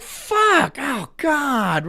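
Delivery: high, sudden mock dismay; spilled-milk panic. Imitating someone who spilled milk, high mock dismay. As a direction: yelp like you just knocked the milk over. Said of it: "You're like".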